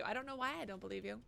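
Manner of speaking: usual speaking voice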